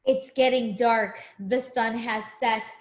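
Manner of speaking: sing-song picture book rhyme; reading to a child. Acted. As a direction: read it like a bedtime rhyme.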